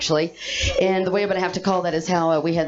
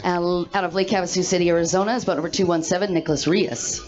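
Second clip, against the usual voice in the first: announcer intro. Acted. Rider introduction.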